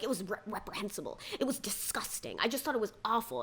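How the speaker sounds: hushed, hurried parody of the women; mocking prim disapproval. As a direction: rattle off the women's hushed scolding. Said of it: "piling on and being like".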